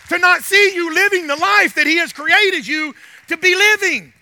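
loud, insistent preacher's cry; full-force preaching. Real, not acted. Preacher telling the congregation God will fix them, loudly. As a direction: drive each phrase home to the congregation.

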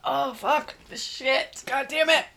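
gagging, groaning in disgust; retching after a nasty shot; about to throw up. Real, not acted. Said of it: "I'm gonna throw up".

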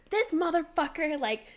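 high, loud retold indignation; sassy outrage. Telling mom about the boss, sassy outrage. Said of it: "I was sassy. I was very sassy".

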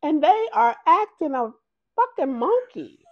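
high, loud disbelief; exasperated retelling. Retold or imitated. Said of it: "I was like".

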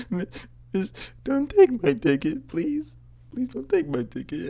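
very high, hammed-up wailing plea; badly faked bawling, dragged out; fake crying. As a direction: beg the teacher in a squeaky mock sob. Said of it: "fake cry".

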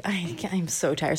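soft, mildly drowsy voice; a touch of weary softness; sleepy sister. Acted. Sister lies down with the straw, mildly sleepy. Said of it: "is so sleepy".

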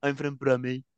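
mock Birmingham accent; Brummie accent. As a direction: imitate a local with a Brummie accent. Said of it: "then they come to Birmingham".

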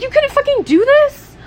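hammed-up disbelief at the roommate; indignant squeal at a roommate's couch sex; mock outraged. Imagined rant at roommate.

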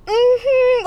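high, loud sassy hum; mock a guy's flamboyant mm-hmm; imitating campy guys. Imitating guys who go like this, campy sass. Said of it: "guys do that shit too".

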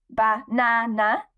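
banana broken up, weight on the middle NA; stress on middle syllable. Shown as a demonstration. Poet shows her interviewer a stressed syllable, banana with stressed middle NA. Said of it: "the stressed syllable because it's".

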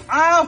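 sharp high yelp of pain. Real, not acted. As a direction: yelp as if struck. Hit by something, cries out.

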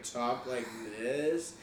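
slow, low California drawl; California accent. Shown as a demonstration. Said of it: "California accents were so bizarre".

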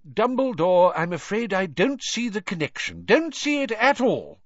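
1 Fudge the minister. A narrator's reading. Fudge character voice.

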